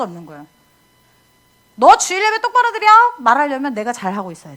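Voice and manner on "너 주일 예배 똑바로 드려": sharp raised scolding voice, higher and louder; stern parent order. Retold or imitated. Parent ordering child to attend worship, stern raised voice. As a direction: bark it like a strict parent.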